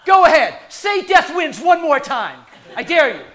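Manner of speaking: Samuel Jackson impression. Angel daring death to speak, loud Samuel Jackson menace. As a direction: growl the dare like a movie tough guy taunting death. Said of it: "Samuel Jackson".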